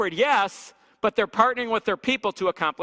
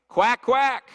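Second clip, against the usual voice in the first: loud, higher quack, quack; mock duck quack; 5 semitones higher, 6 dB louder than usual. Preacher mocking staff who quack like ducks, loud mock quack. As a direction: ham up a duck to mock the office. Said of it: "it's a duck pond".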